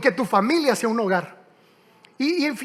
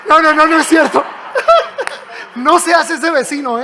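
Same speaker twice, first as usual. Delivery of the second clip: laughing; 4 semitones higher, 7 dB louder than usual. Real, not acted. Laughs at own joke.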